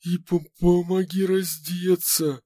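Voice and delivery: shivering, stammering high; teeth chattering. A narrator's reading. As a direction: push it out through chattering teeth. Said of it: "стуча зубами".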